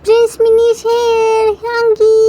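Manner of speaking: put-on high, slow gleeful child's call; a boy announcing himself as he runs; calling out proudly; happy shout. A narrator's reading. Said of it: "while shouting".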